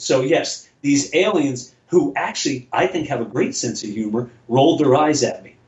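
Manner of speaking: usual speaking voice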